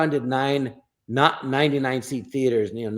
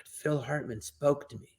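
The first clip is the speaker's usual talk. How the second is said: hushed, slow wonder; awestruck; 8 dB quieter, slower than usual. Retold or imitated. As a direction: say it softly and slowly, starstruck. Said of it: "that's how big a deal he was".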